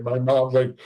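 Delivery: ordinary delivery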